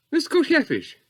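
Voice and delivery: lightly put-on high lady voice; imitated lady, high and loud; lady's voice. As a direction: pitch it up for a mock official lady. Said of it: "She sort of talked like that 'cause she's a lady".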